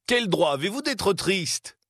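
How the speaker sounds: cheerful teasing. A narrator's reading. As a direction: tease the grumpy uncle gaily. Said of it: "reprit gaiement le neveu".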